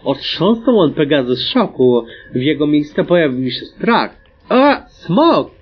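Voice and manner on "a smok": mock shriek. A narrator's reading. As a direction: shriek it lightly, pitch jumping up. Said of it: "wrzasnęła Classicer". (lekko udawany wrzask)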